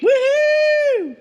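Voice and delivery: high, loud whoop of joy; big happy woohoo; cheering woohoo. Real, not acted. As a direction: cheer it like a party. Cheering at news of no guest.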